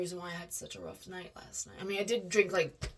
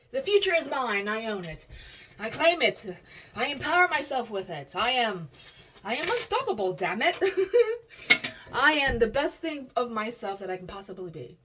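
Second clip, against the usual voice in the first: loud, higher, fired-up self-empowerment; pumped-up declaration; 3 semitones higher, 7 dB louder than usual. Real, not acted. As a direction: declare it like a rallying cry. Empowerment pep talk.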